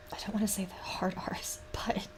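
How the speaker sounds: sheepish whisper with a laugh. Real, not acted. Joking to chat about hard R's, embarrassed whisper. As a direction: hush it like a naughty joke.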